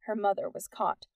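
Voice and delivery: ordinary delivery, nothing marked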